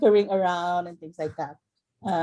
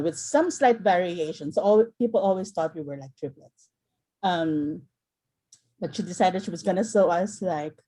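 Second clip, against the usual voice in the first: ordinary talk, nothing marked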